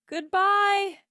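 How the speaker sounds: loud, high farewell call; mother shouting goodbye down the hall; shouted goodbye. A narrator's reading. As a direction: shout goodbye after him. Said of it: "his mother shouted".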